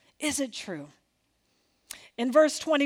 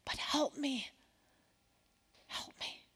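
whispered plea for help; desperate whisper; 14 dB quieter than usual. Pretend. Humble believer begging Jesus for help, whispered. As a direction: breathe it out, begging.

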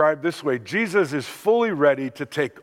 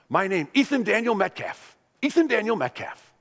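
put-on higher, sing-song little boy; proud toddler voice; 7 semitones higher than usual. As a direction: announce your name like a proud three-year-old. Toddler grandson stating his name.

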